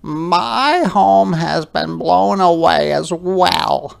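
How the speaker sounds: a small creature deciding bravely; little hedgehog voice. Acted. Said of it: "said Little Hedgehog".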